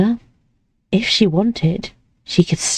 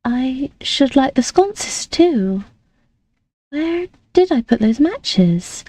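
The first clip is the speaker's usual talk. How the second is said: put-on light, higher girlish voice; young lady voice; 6 semitones higher, slower than usual. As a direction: play the sweet, naive young lady. Reads Amelie's lines.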